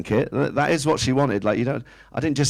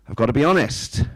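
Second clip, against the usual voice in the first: ordinary talk, nothing marked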